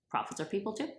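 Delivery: usual speaking voice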